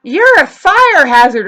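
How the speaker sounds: loud, high, slow mimicry; mocking the kids. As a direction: blare their accusation back mockingly. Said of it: "Telling me".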